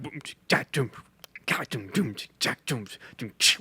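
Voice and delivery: soft beatboxed drum machine groove; beatbox the pattern under your breath; imitated drum beat. Shown as a demonstration. Composer recalls a [name] song's beat, imitated drum machine.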